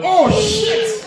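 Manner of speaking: hammed-up high panic with laughter; panicked Nigerian man. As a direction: freak out over the bag blocking the exit. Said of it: "go like".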